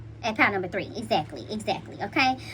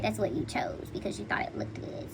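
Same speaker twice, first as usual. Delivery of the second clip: whispered aside; 5 semitones lower, 12 dB quieter than usual. Real, not acted. Reader confiding to viewers, whispered aside. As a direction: drop into a secretive whisper.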